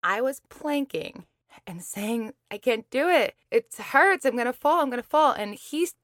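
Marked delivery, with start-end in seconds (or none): 2.5-5.3 high, loud, strained protest; retold panicked yelling. Planker complaining to trainer mid-plank, retold yelling. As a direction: yell it out in rising panic, as a retelling. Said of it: "I'm yelling".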